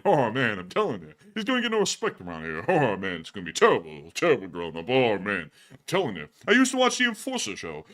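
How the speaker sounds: Rodney. Acted. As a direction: moan comically about your miserable life. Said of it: "with that Rodney voice".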